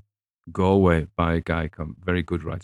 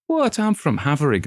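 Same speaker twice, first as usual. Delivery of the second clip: indignant local; 9 semitones higher, 5 dB louder than usual. Retold or imitated. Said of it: "one guy says".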